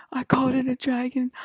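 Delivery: mock panic on the phone; imitating a frantic call home; retold panicked call. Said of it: "and I was like".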